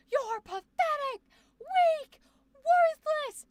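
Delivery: high, loud mock villain yell. Shown as a demonstration. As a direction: yell it like an over-the-top villain. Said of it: "villain yelling scenario".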